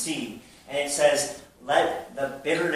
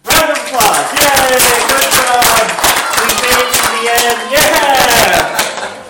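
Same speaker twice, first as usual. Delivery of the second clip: very loud, high whooping cheer; rousing cheer; 9 semitones higher, 16 dB louder, slower than usual. Real, not acted. Preacher cheering the congregation for reaching chapter's end, shouted. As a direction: shout to whip up the applause.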